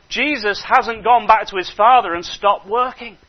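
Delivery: ordinary talk, nothing marked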